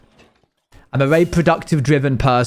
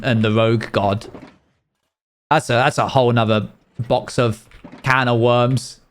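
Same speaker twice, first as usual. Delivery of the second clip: ordinary delivery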